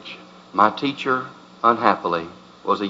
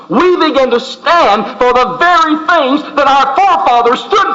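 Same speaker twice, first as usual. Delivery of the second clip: building sermon; 9 semitones higher than usual. Acted. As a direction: build from steady preaching to a roaring climax. Preaching rises to a climax.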